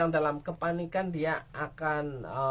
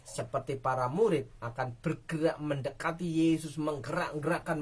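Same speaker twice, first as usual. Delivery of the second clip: normal talking voice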